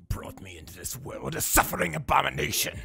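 whispered, very low monster; low whispered creature voice. Pretend. Mr. Poopybutthole-style creature monologue.

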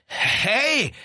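high, loud indignant hey; brother's high, loud protest. A narrator's reading. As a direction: yelp in outrage.

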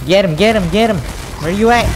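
high, loud urging; urgent shouting. Real, not acted. Gamer hunting an enemy, urgent high shout. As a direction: call out fast in the heat of the chase.

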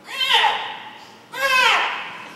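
high, loud croaking shriek; raven-like shriek. Acted. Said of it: "to shriek aloud, and it gives an example like a raven".

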